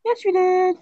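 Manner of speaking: high-pitched taunting yes you did; mocking high squeak. Childish back-and-forth argument.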